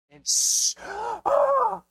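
sharp high gasp and yelp of pain; high yelp at a hot stove; burned gasp. Retold or imitated. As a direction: gasp like a kid who just touched the stove. Kid burns hand on stove.

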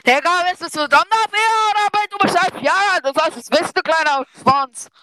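loud, high exasperated rant; exasperated shouting; loud scolding. Real, not acted. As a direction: yell at your clueless friend. Mock scolding of clueless friend.

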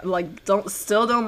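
ordinary delivery

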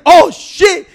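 loud, high startled exclamation; retold scare. Man retelling his bathroom mistake, playing scared. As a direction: act out a sudden fright, loud and high. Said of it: "I got so fucking scared".

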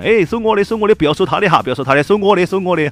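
shouting over each other to pay; loud arguing. Retold or imitated. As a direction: insist loudly on paying the bill. Two men fighting to pay bill.

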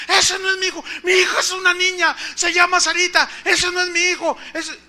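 loud, high mock-frantic protest; hysterical yelling. Mother rejecting the baby boy, hammed-up frantic yelling. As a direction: shout it in hammed-up hysterical refusal. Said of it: "y lloraba y gritaba".

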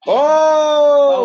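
sudden high loud oh; startled cry; shocked shout. Real, not acted. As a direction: yelp at the hit. Shocked reaction to wrestling hit.

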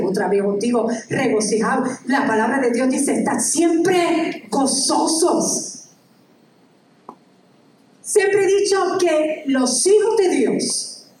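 ordinary talk, nothing marked

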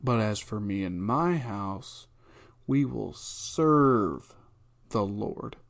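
serve drawn out very slowly and leaned on; stress on serve. Shown as a demonstration. Host showing the verse with the weight on serve. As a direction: weigh down the word serve, stretch it. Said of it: "The emphasis there is".

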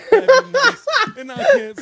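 high laughing words. Real, not acted. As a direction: laugh through the line. Laughs mid-rap.

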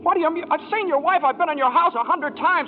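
insist, hurt he doesn't know you; indignant disbelief. Acted. George protesting to Ernie the cabbie, indignant.

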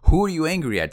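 loud, high insistent demand. Retold or imitated. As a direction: demand an answer as a coach losing patience. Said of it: "my coach raised his voice".

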